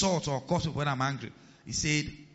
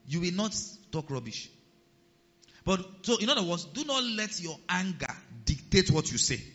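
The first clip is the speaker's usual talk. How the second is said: normal talking voice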